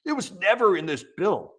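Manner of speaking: lightly voiced mock spokesman; mocking official voice. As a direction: parody a smug spokesman brushing it off. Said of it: "that's exactly how they say it".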